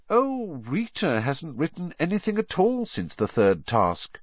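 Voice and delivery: high, held-back voice; constrained, high-pitched reply; oddly constrained. A narrator's reading. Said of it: "in an oddly constrained voice".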